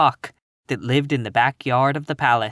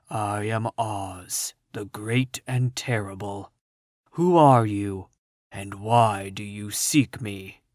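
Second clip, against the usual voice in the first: deep, hushed voice of the great Oz; low quiet Oz voice; 4 semitones lower, slower than usual. A narrator's reading. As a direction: speak low and slow like a hidden wizard. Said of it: "a low, quiet voice came from the ball of fire".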